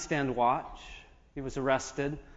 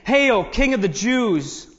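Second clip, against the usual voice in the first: higher, louder, drawn-out mocking cry; mocking hail; 7 semitones higher, 6 dB louder, slower than usual. A narrator's reading. Soldiers jeering at their prisoner Jesus, mocking. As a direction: proclaim it slowly in mock homage. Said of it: "They're mocking him and ridiculing him".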